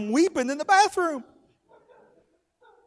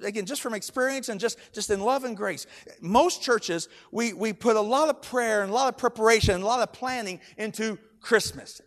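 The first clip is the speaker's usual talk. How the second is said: usual speaking voice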